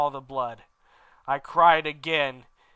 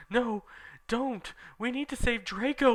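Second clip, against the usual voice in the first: begging; 9 semitones higher than usual. Acted. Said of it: "we begged".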